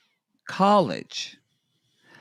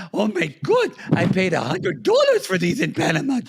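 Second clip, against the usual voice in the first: much higher, louder thrilled gush; 11 semitones higher, 6 dB louder than usual. Retold or imitated. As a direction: gush over a bargain. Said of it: "she was like".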